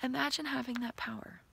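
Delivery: soft unvoiced whisper; whispered invitation. Real, not acted. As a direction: breathe it to the viewer.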